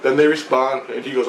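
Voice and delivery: normal talking voice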